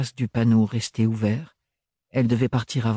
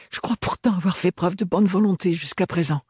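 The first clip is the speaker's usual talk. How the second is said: voiced higher, edged young woman's reproach; Ophélie, tense young woman; 8 semitones higher than usual. A narrator's reading. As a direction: lift the voice for a proud, hurt young woman. (jeune femme blessée et fière, voix plus aiguë)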